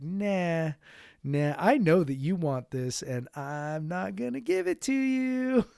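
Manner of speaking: mock taunting child, rising high at the end; teasing, drawn up high on the last words; defiant little kid. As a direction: act out a stubborn toddler's refusal. Voices defiant potty-training kid.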